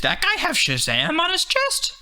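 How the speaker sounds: high, lightly voiced old lady; a curious old woman whispering in the theater. Retelling an old woman asking about trailers, high old lady voice. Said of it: "and she went".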